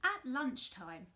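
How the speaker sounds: ordinary delivery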